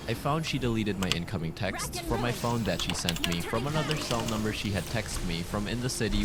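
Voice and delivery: ordinary talk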